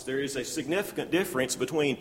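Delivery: ordinary talk, nothing marked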